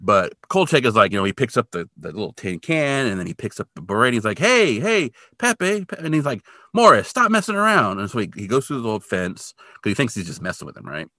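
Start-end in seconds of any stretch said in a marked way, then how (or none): 4.4-8.0 much higher, louder calling out a name. Retold or imitated. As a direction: call after a friend who vanished. Calling out for missing friend.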